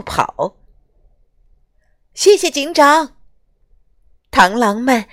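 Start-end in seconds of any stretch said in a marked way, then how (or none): 2.1-3.2 bright thankful call, pitched up; grateful cry. Acted. Insects thank the police chief.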